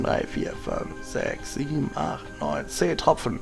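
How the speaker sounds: counting drops under breath; quiet counting. Real, not acted. Counting drops while taking medicine.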